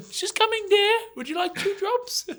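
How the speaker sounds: put-on very high, sweet old-nanny falsetto; Mrs Doubtfire. After the cake in his face, a high falsetto old-nanny impression. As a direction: coo it like a fussy elderly nanny. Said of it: "I looked like Missus Doubtfire".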